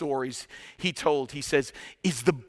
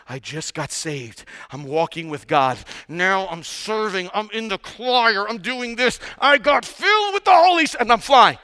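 mock euphoria; 4 semitones higher, 5 dB louder than usual. New believer boasting of serving and flying, mock euphoria. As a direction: get steadily carried away, hammed up.

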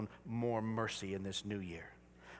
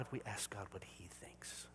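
hushed unvoiced aside; whisper; 5 semitones lower, 16 dB quieter than usual. Real, not acted. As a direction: drop to a confiding whisper.

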